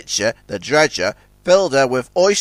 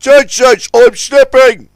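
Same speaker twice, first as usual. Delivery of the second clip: high, loud alarmed cry; alarmed call; 9 semitones higher, 9 dB louder, slower than usual. A narrator's reading. The crane cries to Ten Cents while slipping, alarmed. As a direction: yell for help while sliding. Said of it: "called Scuttlebutt".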